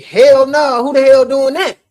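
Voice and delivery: high, loud disbelieving retort; indignant outburst. Retold or imitated. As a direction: fire back in outraged disbelief. Retold indignant reaction to the call.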